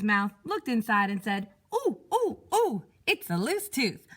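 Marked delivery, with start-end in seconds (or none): 1.7-4.1 high, slow oh, oh; high, slow drawn-out oh then the diagnosis; sing-song dentist. Acted. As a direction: croon like an eager dentist. Dentist peers into mouth.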